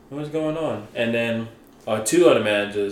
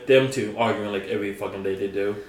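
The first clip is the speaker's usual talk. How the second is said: ordinary delivery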